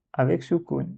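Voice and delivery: ordinary talk, nothing marked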